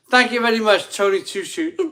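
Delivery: excited thanks. Real, not acted. Thanking a super chat donor.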